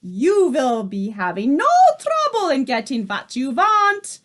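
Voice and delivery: Grand High Witch shouting reassurance to her coven; shouted. A narrator's reading. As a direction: rise up loud on the last word. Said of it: "shouted the Grand High Witch".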